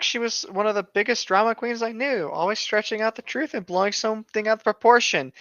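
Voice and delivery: ordinary delivery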